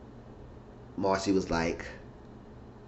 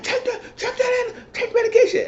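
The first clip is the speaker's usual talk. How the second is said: mock high insisting. [name] keeps insisting she take the pill, high and laughing. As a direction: stretch it out in a squeaky nag.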